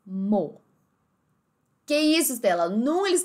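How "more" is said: more as "maw", final R swallowed; British dropped R. Shown as a demonstration. Teacher repeating the word more, British pronunciation. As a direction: end more on the open vowel, British-style. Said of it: "eles simplesmente comem o R e o E".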